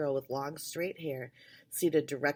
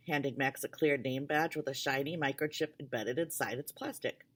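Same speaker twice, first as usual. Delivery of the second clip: ordinary delivery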